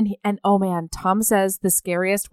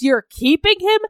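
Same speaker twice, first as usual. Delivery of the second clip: incredulous; 9 semitones higher, 4 dB louder than usual. Retold or imitated. Brother's incredulous retort.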